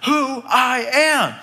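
right in the cashier's face, spelling it out; a hammed-up final outburst of self-importance; mock celebrity yell. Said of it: "and he yells".

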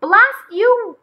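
high, loud, stretched-out curse; furious shout. A narrator's reading. McGreely furious at the surfing bunnies, shouting. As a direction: shout it in high-pitched outrage. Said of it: "he shouted".

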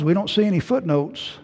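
ordinary talk, nothing marked

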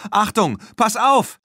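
loud, high shout of alarm; yelled warning. A narrator's reading. Tina to Erik as the bull charges, yelled warning. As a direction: shout to warn someone in danger. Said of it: "brüllte sie".

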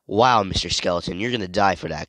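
ordinary talk, nothing marked

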